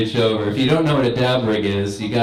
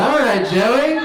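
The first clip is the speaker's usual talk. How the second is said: high-pitched, excited cheer; mock self-cheering; 11 semitones higher, slower than usual. Finder of forgotten mushrooms to himself, delighted cheer. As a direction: hype yourself up, voice shooting high. Cheering his past self's gift.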